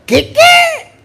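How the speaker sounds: high, hammed-up squeak of shock; mock surprise. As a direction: yelp it in playful disbelief.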